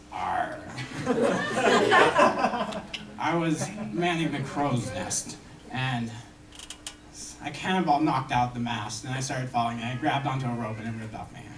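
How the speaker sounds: low gruff pirate growl, dropped well down. Acted. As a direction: growl it like an old sea pirate. Pirate voiced in joke.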